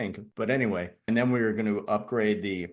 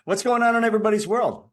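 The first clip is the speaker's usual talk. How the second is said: raised, cheery call to the room; rally the group with a lift; bright call-out; 13 semitones higher, 7 dB louder, faster than usual. Real, not acted.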